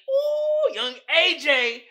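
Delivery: high, slow teasing croon; 1 teasing ooh. Real, not acted. Teasing about the picnic, a high slow ooh. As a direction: croon it like a tease.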